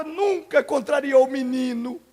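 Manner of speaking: ordinary talk, nothing marked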